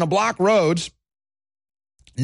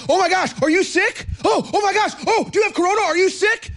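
high-pitched hammed-up panic; mock freak-out; 12 semitones higher than usual. Host confronting a masked shopper in public, mock panic. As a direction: freak out at them in exaggerated alarm. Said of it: "I'm gonna start freaking out".

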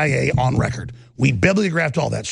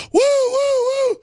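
high drawn-out party whoops; long high mock cheering whoops; mock woo cheer; much slower than usual. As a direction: whoop it up like a rowdy crowd. Cheering hot cheerleaders and guns.

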